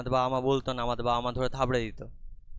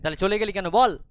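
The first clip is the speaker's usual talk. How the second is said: pressing demand; 7 semitones higher, 7 dB louder than usual. Acted. Mother pressing the boy.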